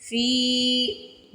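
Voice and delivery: फ़ी with the long ee drawn out; फ़ी pulled out slowly for two counts; stretched fi. Shown as a demonstration. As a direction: hold the vowel of फ़ी long. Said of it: "इसको खींच के अदा करना है".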